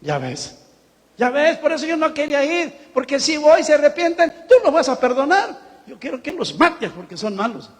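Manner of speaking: Jonás reclamándole a Dios, más agudo y fuerte; Jonás quejándose. Retold or imitated. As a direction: imita a un profeta berrinchudo. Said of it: "qué dijo Jonás". (Jonás le reprocha a Dios con berrinche)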